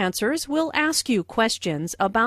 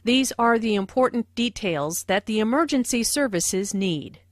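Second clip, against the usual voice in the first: normal talking voice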